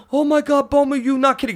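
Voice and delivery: high worried broken English. Retold or imitated. Friend realizing he hurt him, high broken English. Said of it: "he says".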